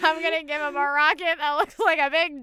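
laughing through a loud spiteful quote; laughing mock designer. Rocket designer mocking Jeff Bezos, loud and laughing. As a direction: giggle while voicing the spiteful rocket designer. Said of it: "They were like".

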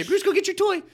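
high, loud call to the dog; sing-song command to a pet. Retold or imitated. Calling the dog at bedtime.

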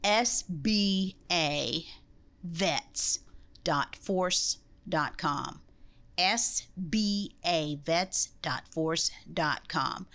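slow, spelled out letter by letter; over enunciating. Shown as a demonstration. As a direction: say each letter slowly and clearly. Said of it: "let me say it again 'cause you said it a little fast".

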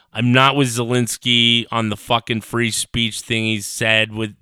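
ordinary talk, nothing marked

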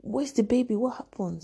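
high, sharp shocked cry; shocked mother. Retold or imitated. Mother hearing her daughter got pregnant, shocked. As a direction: jolt up in disbelief.

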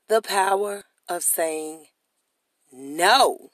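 slow build then a punched no; dramatic title drop. Acted. Podcaster announcing his episode theme, punching no. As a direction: land the word no like a title.

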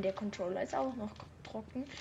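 quiet correction. Real, not acted. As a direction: mutter the correction. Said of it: "Ich meine".

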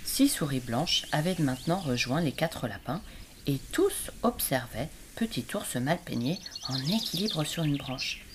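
ordinary delivery

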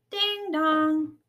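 high, loud two-note ding-dong; doorbell imitation. A narrator's reading. Mother reading imitates Robot's doorbell, chiming. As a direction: sing out a doorbell chime.

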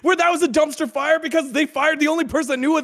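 high, loud exasperated telling; loud venting rant. Real, not acted. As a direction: vent it loudly, half laughing. Venting career story, raised voice.

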